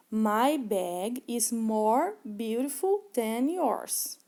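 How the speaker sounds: very slow, deliberate English for learners; slow model sentence. Shown as a demonstration. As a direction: lay each word out like a teacher modeling. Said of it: "no exemplo que eu trouxe". (frase em inglês bem lenta e didática)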